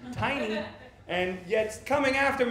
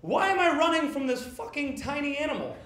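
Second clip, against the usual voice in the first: exasperated; 7 semitones higher, 3 dB louder than usual. Retold or imitated. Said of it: "I was just like".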